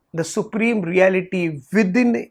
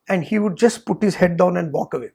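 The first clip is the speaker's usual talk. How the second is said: ordinary talk, nothing marked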